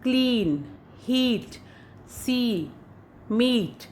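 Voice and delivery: क्लीन, हीट, सी, मीट each with a long ई. Shown as a demonstration. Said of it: "उसको आप बोलेंगे बड़ी ई की तरह". (बड़ी ई की तरह)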